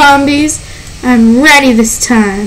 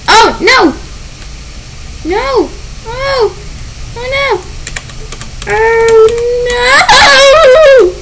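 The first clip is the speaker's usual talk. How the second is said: high-pitched cries of fear; panicked shrieks; 9 semitones higher, slower than usual. Real, not acted. Player shrieking as she is overrun. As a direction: squeal in rising panic.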